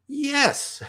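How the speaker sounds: very high, squeaky little yes; delighted child. Retold or imitated. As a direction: answer like an eager child, laughter just behind. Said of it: "as children, right?".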